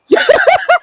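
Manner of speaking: sudden high cackle; high burst of laughter. Real, not acted. Mocking a lonely troll online, bursting into a high cackle. Laughing at her own jab.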